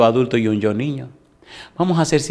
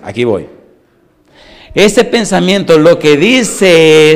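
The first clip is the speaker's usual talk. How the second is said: loud, driving sermon delivery; full-force preaching; 5 semitones higher, 8 dB louder than usual. Acted. Pastor preaching to the congregation about mothers and babies, loud and driving. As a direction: preach it out to the whole church.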